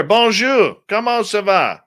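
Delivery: high-pitched Quebecois French greeting; Quebecois accent. Acted. Streamer greeting a viewer from France, in a Quebecois accent. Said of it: "That's a Quebecois accent".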